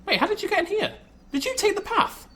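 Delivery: high, loud, startled question; surprised. Real, not acted. Surprised animal got inside.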